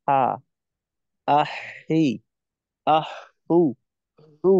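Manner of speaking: হা, হি, হু without leaving the throat point; held ha. Shown as a demonstration. Teacher models holding the ha position for the student. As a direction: keep the place and release each vowel. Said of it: "যেমন".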